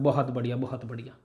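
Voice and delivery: low, very soft aside; murmured praise. Shown as a demonstration. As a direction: drop it right down, almost to yourself. Mock praise of spreading waves, aside.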